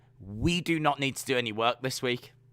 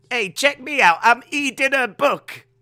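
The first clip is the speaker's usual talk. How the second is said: higher, louder Boston accent put-on; mock Boston accent; 8 semitones higher, 6 dB louder than usual. Podcaster showing off his Boston accent, higher and louder. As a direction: ham up a Boston accent, dropping the R's. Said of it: "Boston accent".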